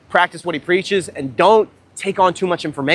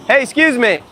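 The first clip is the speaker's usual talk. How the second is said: calling out; 7 semitones higher than usual. Real, not acted. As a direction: call after her as she walks away. Calling after a passing girl.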